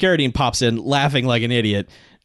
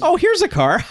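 squeaky mock delight; high, silly voice; goofy high; 3 dB louder than usual. Carradine grabbing the rental car, goofy high voice.

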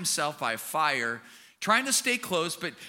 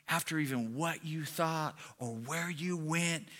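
low, soft, slow condemnation; hushed accuser, weighing each phrase slowly; accusing inner voice; 4 semitones lower, 5 dB quieter, slower than usual. Acted. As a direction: murmur it like a tormenting voice in the head. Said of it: "we start hearing these voices".

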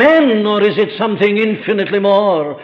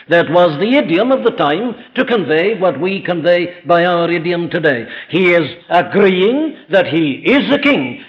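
ordinary talk

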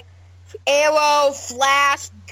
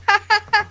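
put-on burst of studio laughter, pitched up; imitated sitcom laugh track; 7 semitones higher than usual. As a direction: fake staccato "ha-ha-ha". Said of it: "like those sitcoms where you hear".

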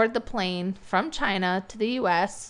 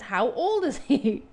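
incredulous repeat question; 4 semitones higher than usual. Retold or imitated. Official doubting the mother about her big son's age, incredulous. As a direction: ask it as if you cannot believe the answer. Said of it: "and they said".

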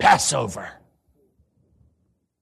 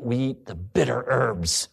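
ordinary delivery

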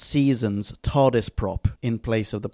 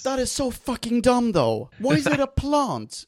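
high-pitched, incredulous rant; exasperated; 9 semitones higher than usual. Real, not acted. Host ranting about a silly plot detail, exasperated. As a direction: complain in disbelief, pitch leaping up. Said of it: "pisses me off".

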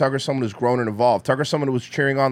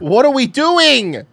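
exasperated outburst; 9 semitones higher, 8 dB louder, slower than usual. Real, not acted.

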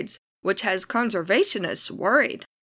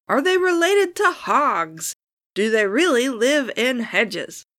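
mock surprise; 5 semitones higher, 3 dB louder than usual. Rhetorical joking question.

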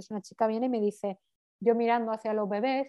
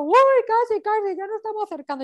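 high-pitched, loud burst easing off in volume; mock excited cheer; excited midwife imitation; 11 semitones higher, 5 dB louder than usual. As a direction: mimic an over-eager midwife, voice shooting up high.

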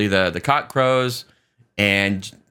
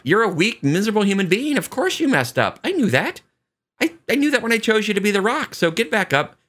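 higher-pitched, animated telling-off; acted fond scolding; 9 semitones higher than usual. Jesus to Peter after he messed up, higher insistent chiding. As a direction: imitate an insistent pep talk, pitched up.

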